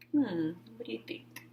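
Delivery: soft unvoiced musing; whispered thought. A narrator's reading. Clark thinking privately, whispered. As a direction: whisper to yourself. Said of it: "thinking aloud".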